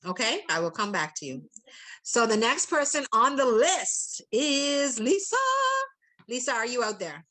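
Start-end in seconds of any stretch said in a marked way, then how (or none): 2.0-5.4 announcing name. Real, not acted. Announcing next speaker.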